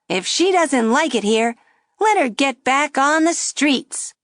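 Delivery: high, loud wisecracking puppet; a brash ventriloquist dummy needling; cheeky dummy voice. A narrator's reading. Dummy mocking the girl at the table, high and brash. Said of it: "the dummy said".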